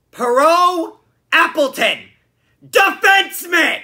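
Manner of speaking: slow, high, loud outburst; exasperated shout. Real, not acted. Fan recounting a botched two-on-one, exasperated shouting. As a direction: shout each name slowly in exasperation.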